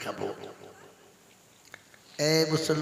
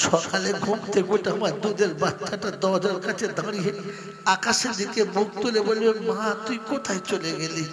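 pleading lament; 7 semitones higher, 5 dB louder than usual. Acted. Daughter pleads for her child.

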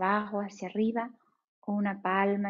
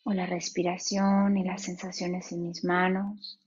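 guided meditation. Acted. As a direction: ease them into stillness, unhurried.

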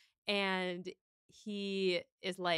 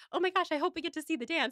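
high, louder, quick gush; excited fan chatter; 8 semitones higher, 3 dB louder, faster than usual. Retold or imitated. Host quoting the Discord fans, mock excited gushing. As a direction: gush eagerly, racing through it. Acting out eager fans' chatter.

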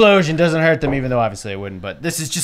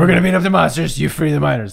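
gargling voice; faster than usual. Acted. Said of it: "little gargling".

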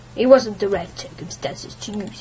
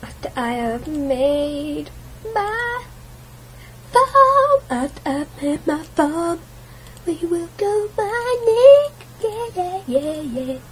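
much higher, slow and drawn out; squeaky sing-song; 8 semitones higher, slower than usual. Pretend. Telling viewers the plan in a silly squeaky voice. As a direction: climb into a squeak on farm. Playful high-pitched plan.

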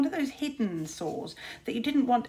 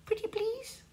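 much higher, sugary mock plea; high, sweet, sarcastic begging; mocking pretty please; 13 semitones higher, 5 dB quieter than usual. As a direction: simper it like a pleading child. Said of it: "if you say them".

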